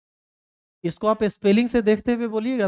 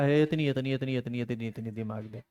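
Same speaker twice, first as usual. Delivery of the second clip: fading to a hush; 5 semitones lower, 6 dB quieter than usual. Retold or imitated. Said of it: "वो गदहा दिया तुम लोग, लोग को हल्का हल्का".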